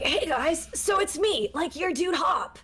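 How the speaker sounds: high-pitched anime fuckboy voice; Hop. Shown as a demonstration. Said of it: "fuckboy voice, and that's the voice for Hop".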